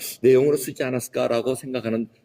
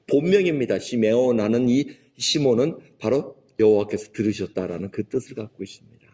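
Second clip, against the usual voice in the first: normal talking voice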